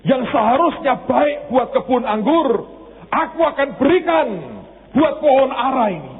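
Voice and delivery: high, ringing preacher's voice; a pastor lifting his voice over the church; fervent preaching. Acted. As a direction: preach it out to the congregation. Preaching on God's promise.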